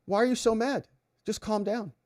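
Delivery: lightly mocking, high-pitched soothing voice; needling calm-down. Someone telling a boiling-mad person to calm down, needling. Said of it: "when you're boiling mad and someone says".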